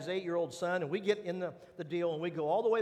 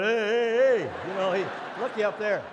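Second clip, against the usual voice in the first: calling up from below; 8 dB louder than usual. Retold or imitated. Son and friends below yelling up at the stuck ride. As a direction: shout up at the stuck dad, teasing.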